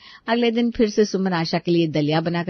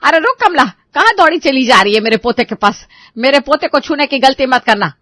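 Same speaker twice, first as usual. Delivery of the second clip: loud, higher-pitched angry order; Asha shouting; 4 semitones higher, 6 dB louder than usual. Acted. Asha stops the maid reaching for her grandson, shouting. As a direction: yell to stop her in her tracks. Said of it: "आशा उसको चिल्ला देती है".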